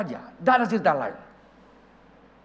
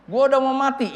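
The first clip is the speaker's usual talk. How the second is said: high, loud mock lament; exasperated protest; 9 semitones higher, 7 dB louder than usual. Old pastor turns down the trip, loud protest.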